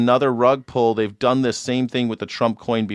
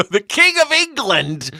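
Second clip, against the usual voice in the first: ordinary talk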